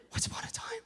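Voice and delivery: whispered, wondrous story opening; hushed storyteller. Acted. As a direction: breathe it softly like a bedtime tale. Savouring a bedtime story opener.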